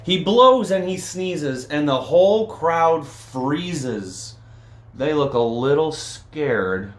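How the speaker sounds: lively read-aloud with bouncy rhythm; storybook reading. A narrator's reading. Reading a picture book to children, lively storytelling. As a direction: read it to the kids with a sing-song lilt.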